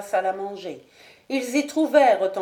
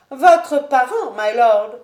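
higher, louder, slow indignant lady; higher, louder proud lady; Lady Ashton; 4 semitones higher, 8 dB louder, slower than usual. Acted. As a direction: lash back at the marquis. Lady Ashton retorts loudly.

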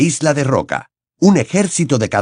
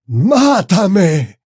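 higher, louder anguished roar; roaring plea; 9 semitones higher, 4 dB louder than usual. Acted. Dumbledore roaring at Harry, begging. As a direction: bellow for death. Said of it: "bramó".

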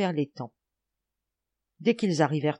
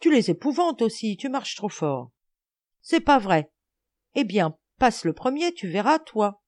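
put-on raised-voice childish bickering; irritated accusation; 5 semitones higher, 4 dB louder than usual. A narrator's reading. Boys blaming each other for scared frogs, irritated. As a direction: trade blame testily. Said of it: "s'accusant réciproquement".

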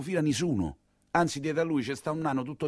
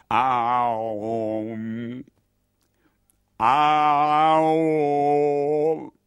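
put-on old woman's call. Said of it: "fa la vecchia".

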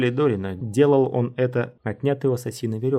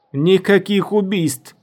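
slow, loud call from afar; distant shout; 9 semitones higher, 7 dB louder, slower than usual. A narrator's reading. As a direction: call out from far away. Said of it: "с большого расстояния".

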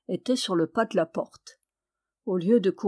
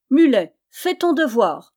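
loud, raised-pitch commanding shout; louder cry; 4 semitones higher, 7 dB louder than usual. A narrator's reading. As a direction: shout the order with insistence. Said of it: "cria plus fort le petit tailleur". (ordre crié bien fort)

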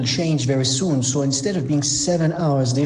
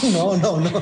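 laughing; 5 semitones higher than usual. Real, not acted. Laughing off a joke.

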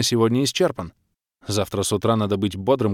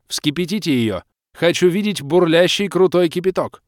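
high, raised, forceful female leader; commanding baroness; 7 semitones higher, 4 dB louder than usual. A narrator's reading. Baroness ordering her fire mages, commanding. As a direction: issue battle orders with authority.

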